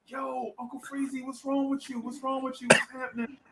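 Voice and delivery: soft, high-pitched girl impression; Delia. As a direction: imitate a concerned little girl, high and hushed. Girl crush asks what's wrong.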